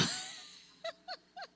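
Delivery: a sudden high burst of laughter; embarrassed high giggle; real laugh. Real, not acted. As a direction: laugh at yourself for getting caught. Caught in a curtain, laughs.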